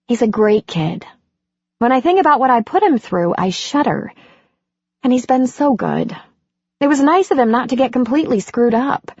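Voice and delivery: lightly voiced regretful mother; Andy's voice. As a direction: speak of your son with guilt and pride. Andy talks about her son.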